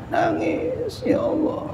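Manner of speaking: very high, soft, thin wail; mock weeping. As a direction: softly imitate crying out to God. Acts out weeping in prayer. (pura-pura menangis lirih dengan nada tinggi)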